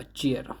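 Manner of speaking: चीयर, opening on ch, not sh; correct pronunciation. Shown as a demonstration. Teacher correcting learners' reading of cheer, right चीयर with ch. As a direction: say चीयर with a "ch" start. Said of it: "चीयर बोला जाएगा".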